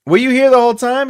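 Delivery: high, bright hello to a viewer who just joined; excited greeting. Real, not acted.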